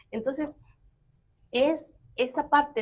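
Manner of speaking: normal talking voice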